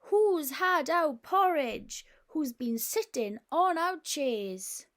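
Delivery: loud, slow indignant bear cry; three cross bears bellowing their complaint. Acted. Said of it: "who cried".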